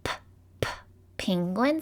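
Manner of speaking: "p, p, penguin" with puffed separate p sounds; sounding out P. Shown as a demonstration. Teacher sounding out the last quiz word. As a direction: puff "p" twice, then say "penguin".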